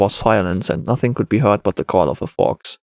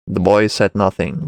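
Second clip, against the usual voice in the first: usual speaking voice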